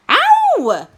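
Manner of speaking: high, loud yelp; scream of pain. A narrator's reading. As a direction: cry out at the jab. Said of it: "the boy screamed again".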